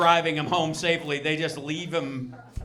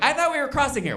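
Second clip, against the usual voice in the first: pitched-up confused knee voice; high whiny knee; 9 semitones higher, 4 dB louder, faster than usual. Pretend. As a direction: squeak it like a lost joint.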